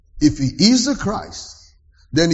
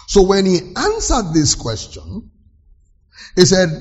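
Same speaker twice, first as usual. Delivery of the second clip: usual speaking voice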